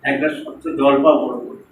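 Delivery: mock-pompous pronouncement; grandiose. Shown as a demonstration. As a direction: puff it up like a big political speech. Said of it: "আপনি বড় political কথা বলবেন".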